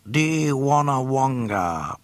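slow, loud alien voice; alien greeting. Acted. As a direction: greet with sly ceremony. Bib Fortuna greeting.

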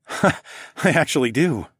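slow, amused reply through a laugh. A narrator's reading. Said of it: "I laughed".

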